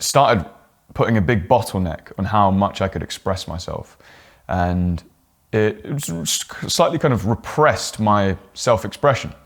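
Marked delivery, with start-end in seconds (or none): none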